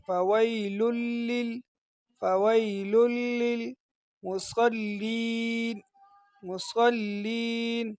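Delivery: لل with all three lams merged, slow; soft slow tajweed demo; joined lams. Shown as a demonstration. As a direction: blend the lams of لِّلْمُصَلِّينَ in one. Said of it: "تینوں لاموں کو ایک ساتھ ملانا ہے".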